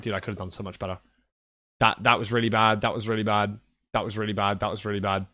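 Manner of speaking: low, soft muttering to oneself; self-reproach. Retold or imitated. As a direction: kick yourself under your breath, low and quiet. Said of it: "I was actually kicking myself afterwards".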